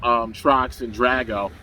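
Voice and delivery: normal talking voice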